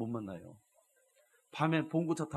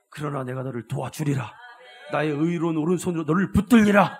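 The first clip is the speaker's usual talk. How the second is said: swelling divine promise; 3 semitones higher, 12 dB louder than usual. Acted.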